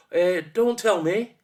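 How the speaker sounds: high, loud, slow searching; struggling to remember. A narrator's reading. Forgetful son stalling his father, slowly searching memory. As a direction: drag it out while racking the brain. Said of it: "couldn't quite remember".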